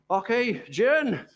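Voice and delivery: usual speaking voice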